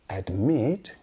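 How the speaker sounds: "admit" stressed on MIT, ad-MIT; second-syllable stress. Shown as a demonstration. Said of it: "has the stress on the second syllable".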